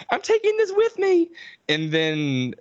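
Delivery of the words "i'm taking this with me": mock Jessica. Mocking Jessica taking the knife, very high squeak. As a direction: squeak it like a prissy horror girl. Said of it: "Jessica goes".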